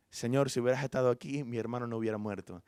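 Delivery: low, softening grief; subdued lament. Retold or imitated. Mary telling Jesus of her brother's death, subdued lament. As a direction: say it quietly, sinking at the end. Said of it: "la vio llorando y lamentando".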